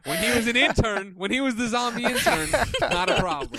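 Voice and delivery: giggling through the words; laughing. Real, not acted. Laughing while joking.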